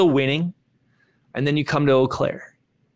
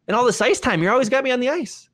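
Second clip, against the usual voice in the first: higher, indignant, fast at the end; protesting; 10 semitones higher, faster than usual. Retold or imitated. Player arguing his stats to coach, indignant higher. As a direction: insist you've earned it.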